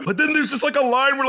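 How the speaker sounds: excited. Real, not acted. Excited build-up, pitch jump.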